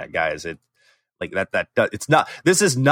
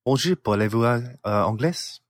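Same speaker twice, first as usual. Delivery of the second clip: bad French accent; 4 dB quieter than usual. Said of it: "he has a horrible accent".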